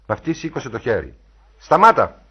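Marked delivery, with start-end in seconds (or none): 1.6-2.2 sharp, loud, high order to halt; commanding cry; shouted command. A narrator's reading. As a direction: shout to stop someone in their tracks. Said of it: "φώναξε".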